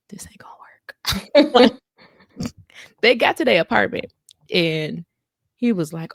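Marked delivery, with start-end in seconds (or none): 0.1-1.0 breathy whispered aside. Retold or imitated. Wife silently doubting her husband, whispered. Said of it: "she be looking at him like".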